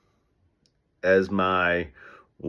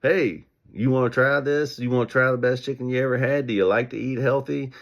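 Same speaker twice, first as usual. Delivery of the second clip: calling out to passersby; 5 semitones higher than usual. Retold or imitated. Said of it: "Me or somebody with me said".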